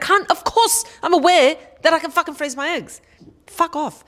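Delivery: high-pitched exasperated outburst; blowing up. Acted. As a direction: blow up at a clueless busybody. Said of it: "that's me blowing up".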